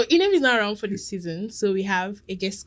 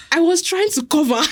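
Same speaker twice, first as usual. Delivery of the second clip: exasperated; 7 semitones higher, 5 dB louder than usual. Real, not acted. Exasperated laugh.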